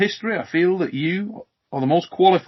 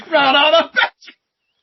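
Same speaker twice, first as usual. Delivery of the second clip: punchline shout; 9 semitones higher, 9 dB louder, slower than usual. Acted. Punchline delivered loudly.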